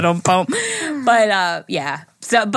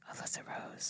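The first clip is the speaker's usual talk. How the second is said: whispering; 26 dB quieter than usual. Real, not acted. Whispered name.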